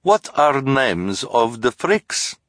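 put-on high, slow foreign accent; sweet fortune-teller with thick accent; Madame Lulu accent. Lulu continues speaking.

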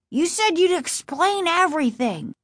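high, protesting insistence; insistent. A narrator's reading. Casey following his father from the closet, insistent. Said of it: "Casey insisted".

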